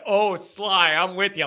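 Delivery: ordinary delivery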